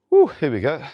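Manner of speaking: pumped whoop. Real, not acted. Starting a heavy set.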